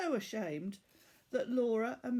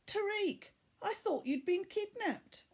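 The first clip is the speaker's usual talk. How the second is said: put-on startled girl; 11 semitones higher than usual. Laura waking, startled.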